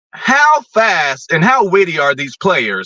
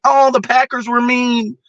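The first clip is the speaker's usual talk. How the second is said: mocking whine; 7 semitones higher than usual. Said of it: "Before you just say a blanket statement".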